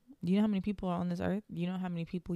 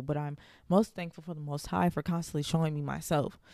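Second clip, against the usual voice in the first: usual speaking voice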